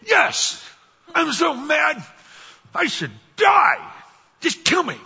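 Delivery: high, loud mock outburst; furious shout. Jonah answering God, hammed-up furious yelling. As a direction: scream it like a sulking prophet who wants to die. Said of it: "You mad, bro?".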